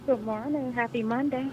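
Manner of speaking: muffled through a face mask; quiet, masked and muffled; talking through mask. Real, not acted. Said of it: "Oh, you whispering".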